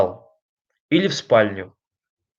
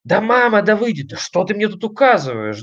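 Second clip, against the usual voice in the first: put-on higher-pitched voice of an exasperated child; irritated child; 7 semitones higher than usual. Child arguing back at mum, irritated and high-pitched. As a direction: act out a child snapping at mum. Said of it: "которой нам говорит ребёнок".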